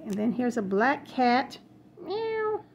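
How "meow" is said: playful meow. Pretend.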